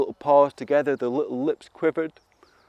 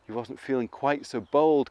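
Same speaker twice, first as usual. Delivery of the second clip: plain reading voice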